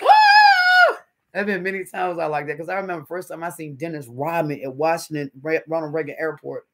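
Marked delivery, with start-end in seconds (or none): 0.0-1.0 very high, loud fangirl scream; starstruck scream. Retold or imitated. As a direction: shriek like you just saw a star. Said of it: "you're like".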